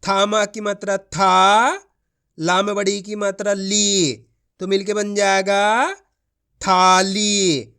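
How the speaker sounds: slow, careful sounding out of each syllable; demonstration reading. Shown as a demonstration. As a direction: show the kids how to blend. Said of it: "देखो किस तरीके से है".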